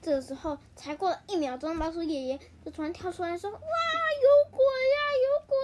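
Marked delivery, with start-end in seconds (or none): 3.5-5.6 very high, loud, slow ghost scream; terrified. Acted. Grandpa mouse bursts out of the tree hole, terrified scream. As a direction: scream as if a ghost grabbed you.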